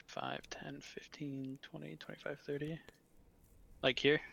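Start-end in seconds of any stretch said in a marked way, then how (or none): 0.1-2.8 whispered counting; counting under breath. Real, not acted. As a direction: count the squares in a hush.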